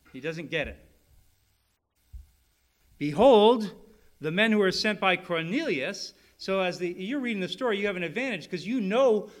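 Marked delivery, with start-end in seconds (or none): 3.0-6.1 dramatic proclamation. Acted. Reading scripture dramatically.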